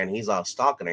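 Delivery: ordinary delivery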